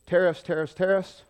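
hammed-up politician shouting tariffs; mock Trump. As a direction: bark the word like a president on TV. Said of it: "and he says".

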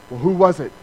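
a touch of surprised curiosity; curious question. Retold or imitated. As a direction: demand to know who. Recipient voiced asking who.

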